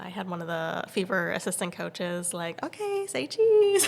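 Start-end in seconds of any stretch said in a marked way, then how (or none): 2.6-3.9 high, slow sing-song; imitated cheery photographer; coach taking photo. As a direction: draw it out for the camera.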